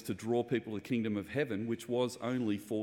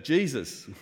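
louder, higher called-out answer; yelled answer; 5 semitones higher, 7 dB louder than usual. Retold or imitated. Congregation member shouting out the answer, loud. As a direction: shout it from the pews. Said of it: "someone yells out".

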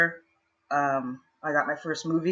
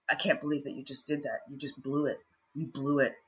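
hushed reprimand; 2 semitones lower, 9 dB quieter than usual. Retold or imitated. Casting director to actress once camera is off, hushed reprimand. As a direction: say it very softly, in grave disbelief.

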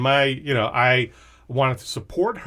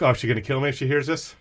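usual speaking voice

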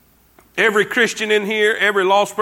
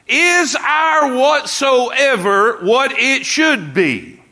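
high, slow shouted question; preaching shout; 5 semitones higher, 3 dB louder, much slower than usual. Real, not acted.

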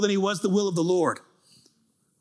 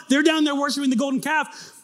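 ordinary talk

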